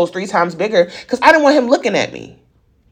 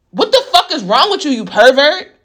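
outraged; 6 semitones higher than usual. Real, not acted. Outraged at abuser.

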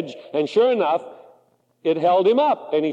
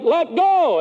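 voice from above; 8 semitones higher than usual. Acted. The voice from above answers.

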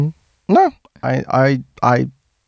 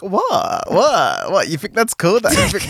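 swooping high disbelieving questions; comic feigned incredulity; mock bewildered; 6 semitones higher, slower than usual. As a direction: play at being baffled, pitch jumping about. Acts out flustered French-speaking character.

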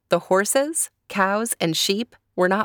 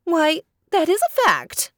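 high clucking hen; 11 semitones higher than usual. Acted. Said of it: "clucked the brown hen".

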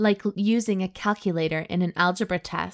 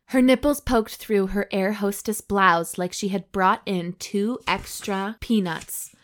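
usual speaking voice

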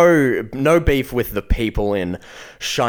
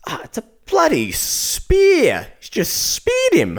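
much higher, slow outraged squawk; hammed-up high indignant lady; old lady voice; 4 dB louder, slower than usual. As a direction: drag it out like an outraged fan. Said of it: "she goes".

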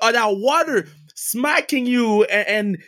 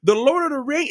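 normal talking voice

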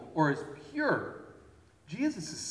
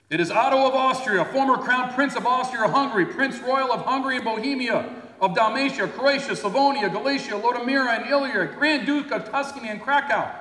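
higher, louder herald listing royal titles; grand proclamation; 8 semitones higher, 7 dB louder than usual. Retold or imitated. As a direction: boom it out like a court herald. Proclaiming titles at church door.

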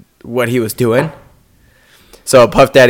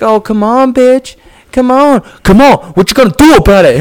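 taunting Joker; 12 semitones higher, 5 dB louder than usual. Retold or imitated. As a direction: goad him like a crazed clown. [name] taunting in costume.

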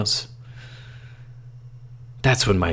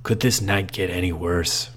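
ordinary delivery, nothing marked

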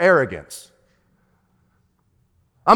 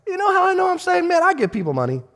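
jumps much higher, quick smug brag; mock boast; 14 semitones higher, faster than usual. Pastor mocking his own smug thoughts, playful boast. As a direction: parody a self-satisfied believer. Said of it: "I'm like".

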